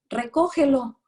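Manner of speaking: high, loud snapped order; parent's order. Retold or imitated. Lecturer voicing a parent yelling at the child, high and loud. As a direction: yell it like an annoyed parent.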